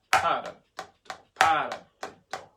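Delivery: PA of Paradiddle punched loud, rest falls away; strong first beat on paradiddle; stressed first syllable. Shown as a demonstration. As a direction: hit the start of paradiddle, let the rest drop. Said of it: "play the rest of the paradiddle lower".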